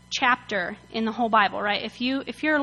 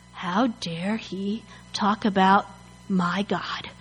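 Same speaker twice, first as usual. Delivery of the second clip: low, slow mock outrage; measured, affronted disbelief; offended; 4 semitones lower, 3 dB quieter, slower than usual. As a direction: take offence with slow, weighty words. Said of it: "[name] gets offended".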